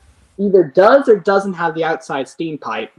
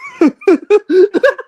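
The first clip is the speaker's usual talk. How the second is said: evil laugh; 13 semitones higher, 5 dB louder than usual. Acted. Villain gloats after wrecking film.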